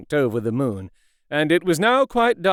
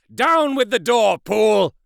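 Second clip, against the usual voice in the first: high, louder command; urgent order; 7 semitones higher, 4 dB louder than usual. A narrator's reading. Utterson ordering the butler to break the door, urgent shout. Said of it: "cried Utterson".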